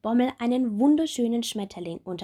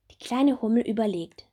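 plain reading voice

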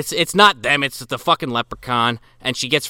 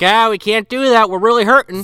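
high, louder mock of the dad; mock poor dad; 8 semitones higher, 4 dB louder than usual. Dad refusing his daughter, high whiny mock. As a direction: whine about being broke. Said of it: "He's like".

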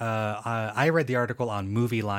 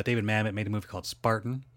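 ordinary delivery

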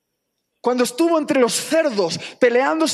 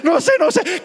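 high, loud, hammed-up frantic wail; mock tearful panic; 7 semitones higher, 4 dB louder than usual. Husband phoning the pastor about disaster, imitated frantic wailing. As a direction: blurt it out like a desperate caller. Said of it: "me llamó el hombre llorando".

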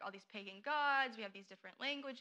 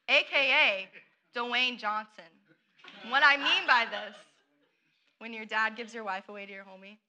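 usual speaking voice

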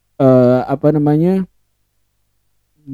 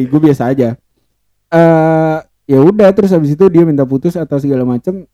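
usual speaking voice

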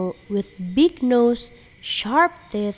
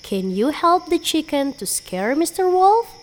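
plain reading voice

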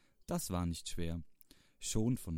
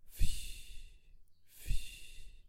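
little mouse snoring. A narrator's reading. Mouse asleep under duck's bed, snoring. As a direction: wheeze out two airy snores. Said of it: "schnarchte leise".

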